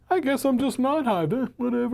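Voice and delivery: high, careless mock shrug; shrugging indifference. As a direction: toss it off like nobody cares. Mocking the shrugging unaffected people.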